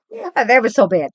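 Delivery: ordinary talk, nothing marked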